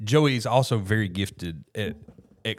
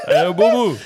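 high, booming cartoon bear call; Yogi Bear; 10 semitones higher, 10 dB louder than usual. Retrying the impression despite a cough, loud bear voice. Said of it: "Yogi Bear".

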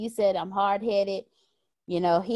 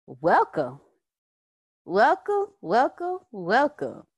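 rising, louder repeated welcomes, pitched high; extra welcomes; 3 semitones higher, 5 dB louder, slower than usual. Real, not acted. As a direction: greet her with a sing-song of welcomes. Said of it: "putting the extra welcomes in there".

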